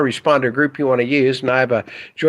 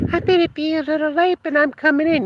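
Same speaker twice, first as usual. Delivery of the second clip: imitating wife; 12 semitones higher than usual. Wife's call to work, retold.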